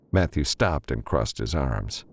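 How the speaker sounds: plain reading voice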